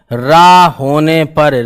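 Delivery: drawn-out, deliberate words; slow dictation. Real, not acted. Teacher dictating the next piece of the rule, slow dictation. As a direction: spell out the rule slowly.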